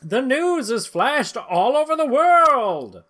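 hammed-up newsreel narrator; dramatic narration. A narrator's reading. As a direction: announce it like a newsreel. Narrates headline.

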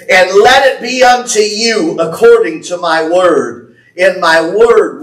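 ringing prophetic declaration; preaching at full force. Real, not acted. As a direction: declare it over the church.

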